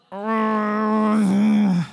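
tense groan of dread; nervous groan. Real, not acted. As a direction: let out an uneasy ugh. Nervous before sharing.

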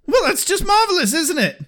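put-on high, fast sarcastic British accent. Quoting Giles losing his temper, fast sarcastic British accent. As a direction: sneer it in a posh British accent. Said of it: "real British accent".

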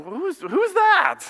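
God squinting at a stranger's prayer; God puzzled. Retold or imitated. Preacher voicing God puzzled by a fake prayer, high and slow.

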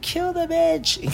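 squeaky high put-on voice for a crazed order; high mock snarl. Host imitates Morty ordering the kill in a high mock voice. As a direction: play a snapped Morty, shrill and gleeful. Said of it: "he's like".

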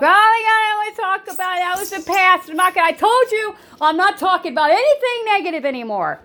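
high-pitched, hammed-up indignant outburst; mock a narcissist refusing to listen, high and worked up; exasperated refusal. Narcissist shutting down talk of the past, exasperated mock outburst.

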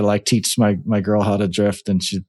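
normal talking voice

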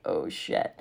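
caught-out whisper; hushed oh shit. Retold or imitated. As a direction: breathe it out like you're caught. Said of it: "We're like".

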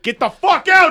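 high shouted get-out; mock shout. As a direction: yell them out of the party. Romans shouting Jesus out.

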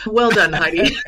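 mock pat on the back, laughing; laughing self-praise. Real, not acted. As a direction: congratulate yourself through a laugh.

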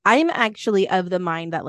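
ordinary delivery

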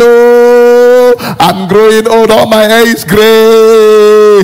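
high, long-drawn theatrical wail; mock-lament like a mother pleading for grandchildren. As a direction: drag out each cry, high and keening. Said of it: "your mother, your mother just say".

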